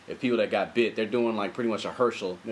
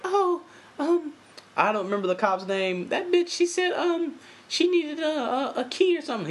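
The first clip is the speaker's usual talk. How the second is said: put-on much higher girlish voice; Beth in a high voice. Beth answering the cop, in a high girlish voice. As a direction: pitch it up into a girl's voice. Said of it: "she's just like".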